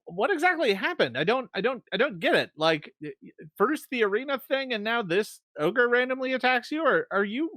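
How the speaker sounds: high-pitched, flustered questioning; Coxo's voice. Acted. Coxo asking the party what happened, high flustered voice. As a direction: stammer it high, confused and concerned. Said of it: "this is Coxo's voice here".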